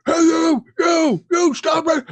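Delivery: high, barked mock police shout; military police voice. Wardens yelling orders at the driver, mock police shout. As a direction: bark it like a cop ordering a stop. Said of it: "their military police voices".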